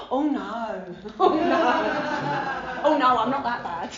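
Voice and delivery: laughing through a loud protest; giggling oh no, no, no; laughing denial. Retold or imitated. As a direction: laugh it off, loudly. Laughing denial of being addict.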